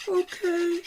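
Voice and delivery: put-on high quavery old lady "Okay"; little old lady voice. Shown as a demonstration. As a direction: squeak it like a sweet old lady. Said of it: "little old lady's back".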